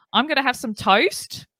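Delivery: put-on "toast" drawled in a broad Australian accent; imitating an Australian soap character. Retelling a soap opera line in a mock Australian accent. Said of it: "a character went".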